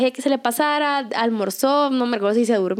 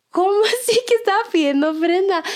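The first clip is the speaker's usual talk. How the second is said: incredulous question; 8 semitones higher than usual. Retold or imitated. Mom probing further.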